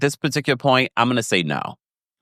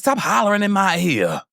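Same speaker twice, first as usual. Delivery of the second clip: imitating Nelle hollering; 7 semitones higher than usual. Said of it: "Nell's like".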